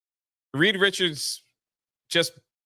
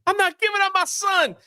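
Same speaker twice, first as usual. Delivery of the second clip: wife. Retold or imitated. Wife's line, voiced high.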